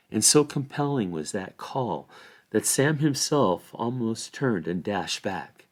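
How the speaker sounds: ordinary delivery, nothing marked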